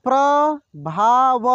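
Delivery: प्रभाव drawn out slowly, syllable by syllable; slow sounding out. Shown as a demonstration. Teacher slowly sounds out प्रभाव for students. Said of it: "एक एक मात्रा को अलग अलग करके".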